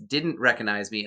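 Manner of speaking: usual speaking voice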